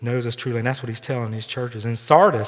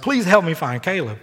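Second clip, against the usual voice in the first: retold scream; 5 semitones higher, 5 dB louder than usual. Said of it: "I screamed to them".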